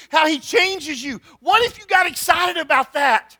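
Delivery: loud, high, impassioned preaching; preaching at full force. Acted. Urging students to get excited about God, full-force preaching. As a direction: build it into a rousing call to the congregation.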